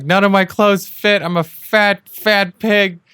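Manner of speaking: high, loud self-pitying wail; hammed-up meltdown over nothing fitting; mock despair. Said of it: "just crumpled up in the corner".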